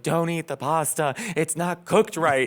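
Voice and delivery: high, drawn-out retold scolding; mock yelling. Roman woman scolding the hungry model off her pasta, mock yelling. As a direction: imitate an exasperated cook yelling, stretching each word. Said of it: "she's yelling at me in Italian".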